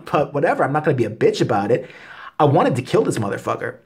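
ordinary delivery

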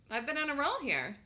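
normal talking voice

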